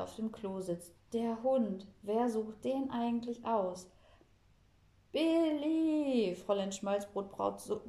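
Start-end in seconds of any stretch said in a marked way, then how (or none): 5.1-6.3 calling out a name at full voice; loud yell. A narrator's reading. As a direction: bellow the name from the loo. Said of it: "brüllt so laut".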